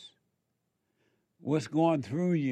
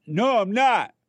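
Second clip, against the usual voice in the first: louder, higher mock denial; mock angry denial; 7 semitones higher, 7 dB louder than usual. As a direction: bark a defensive no. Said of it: "you defend it".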